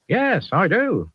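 slow, high West Country drawl; a Somerset farmer agreeing. Acted. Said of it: "I think he's from the Southwest".

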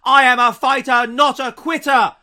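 loud, high, defiant slogan, the last words slowed; defiant declaration. Retold or imitated. Impersonating the prime minister shouting her defiance at PMQs. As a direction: belt it out like a cornered fighter. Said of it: "where she goes".

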